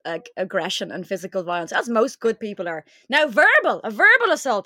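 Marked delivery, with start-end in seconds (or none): 3.1-4.7 louder, stressing verbal; emphatic boast. Real, not acted. Friend boasting she can handle a verbal assault, emphatic and louder. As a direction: lean hard on the word verbal.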